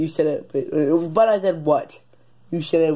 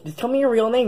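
usual speaking voice

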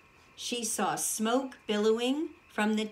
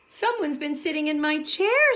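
louder, higher indignant line; 6 semitones higher, 6 dB louder than usual. Acted. Father Bear complaining about his chair, louder. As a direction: complain that your chair was used.

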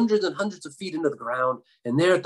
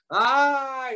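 high-pitched storyteller's yelp; sudden held cry of pain, acted; high mock scream; 10 semitones higher than usual. A narrator's reading. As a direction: yelp high as if hair was plucked. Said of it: "he screamed".

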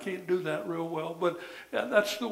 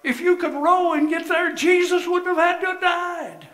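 loud, high, ringing preacher's cry; preaching at full force; 9 semitones higher, 10 dB louder than usual. Acted. Preacher shouting to the church that grace was needed, full force. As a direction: drive it home to the congregation.

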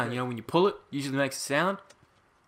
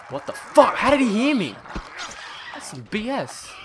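loud startled outburst; shocked shouting; 11 semitones higher, 17 dB louder than usual. Real, not acted. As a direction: yell it in shock, laughing. Zombie hears him, flamethrower out.

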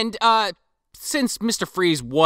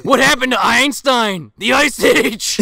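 laughing villain pun; 6 semitones higher, 6 dB louder than usual. Acted. Echoing the Mister Freeze pun.

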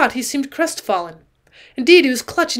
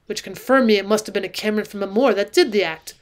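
ordinary delivery, nothing marked

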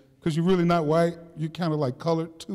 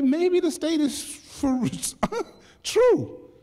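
slow and high, breaking into a laugh; laughing disbelief; 8 semitones higher, much slower than usual. Retold or imitated. Prisoner laughing at his own bad reputation, slow and high. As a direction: let the laugh crack through the last words. Laughing at himself, retold thought.

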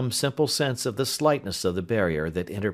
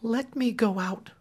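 soothing. A narrator's reading. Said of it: "soothing her".

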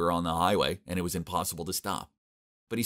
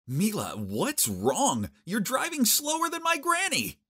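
startled complaint; slower than usual. A narrator's reading.

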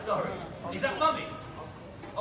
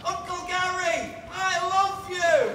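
slow, higher-pitched call with drawn-out words; demonstrated shout-out; 4 semitones higher, slower than usual. Shown as a demonstration. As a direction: call it out big, stretching each word. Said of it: "You're gonna shout out".

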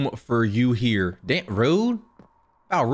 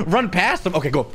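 normal talking voice

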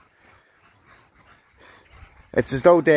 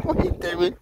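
amused laugh; faster than usual. Real, not acted. Laughing at the dog's antics.

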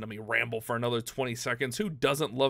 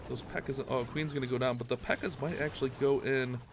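ordinary delivery

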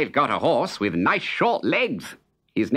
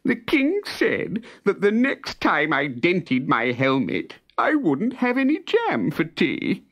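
tearful lament; 13 semitones higher than usual. Acted. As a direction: whine it through tears, much higher. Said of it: "sobbed the soldier".

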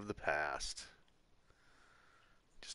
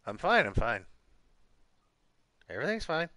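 mock reassurance; 8 semitones higher, 5 dB louder than usual. Pretending fine while failing.

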